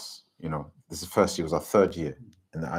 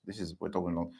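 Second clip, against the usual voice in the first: normal talking voice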